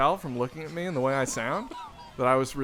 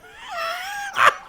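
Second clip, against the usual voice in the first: loud laugh; 7 dB louder than usual. Real, not acted. Laughing at stripper detail.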